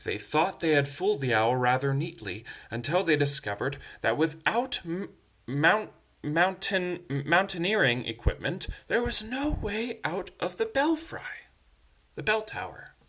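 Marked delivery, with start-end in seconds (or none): none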